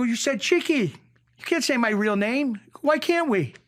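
high-pitched, worked-up objection; retold protest. Extra arguing with the actor over names, retold protest. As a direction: argue back, reenacting the mix-up. Retelling his on-set protest.

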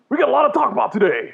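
high, loud, jacked-up announcer; radio voice. Pretend. Host hyping the show in over-the-top radio voice. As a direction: belt out the show intro like a wrestler. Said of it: "doing a more radio voice".

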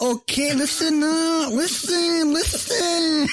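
high, pleading okay listen; high-pitched calls to listen; calling out. Real, not acted. Calling for attention.

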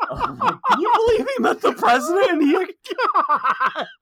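very high, loud laughing squeal; words breaking up into cackles; hysterical laughter. Acted. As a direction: talk through helpless high-pitched laughter. Host cracking up after the act.

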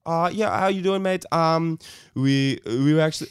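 put-on friendly bloke putting on a South African accent. Hamming up a South African accent to a stranger at his door. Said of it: "actually did a South African accent to this guy".

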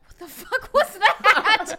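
loud, slow, drawn-out mock outrage; high, laughing cry of disbelief; retold outraged disbelief. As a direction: belt it out while breaking into laughter. Retelling shocked reaction to jingle.

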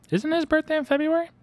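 high, loud surprised question; surprised friend. Retold or imitated. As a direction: squeal it in disbelief. Mother's friend voiced, surprised.

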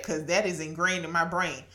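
ordinary talk, nothing marked

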